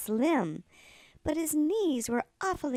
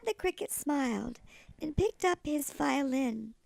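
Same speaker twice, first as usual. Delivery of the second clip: ordinary delivery, nothing marked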